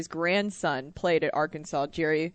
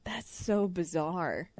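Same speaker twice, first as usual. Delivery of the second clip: slow, softer, letting it sink in; drawn-out, softer disbelief at a strange story; slow, softer amazement; stunned disbelief; 6 dB quieter, much slower than usual. Real, not acted. Reacting to bathroom story.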